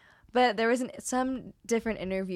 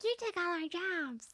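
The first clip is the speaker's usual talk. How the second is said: high, drawn-out mimicry; mock whine of a quoted line; mocking imitation; 9 semitones higher, 5 dB quieter, slower than usual. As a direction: stretch it out in a silly high voice. Mimicking Phyllis's stupid line.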